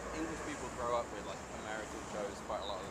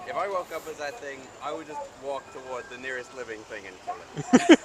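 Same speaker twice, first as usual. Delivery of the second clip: Shake impression; 3 semitones higher, 15 dB louder than usual. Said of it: "and Shake's just like".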